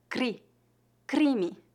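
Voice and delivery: "Cri" and "crime" with R tapped on the palate. Shown as a demonstration. Teacher showing learners the CR cluster, tapped R. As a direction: say "Cri", then "crime", tongue-tip R. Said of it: "vibração da ponta da língua".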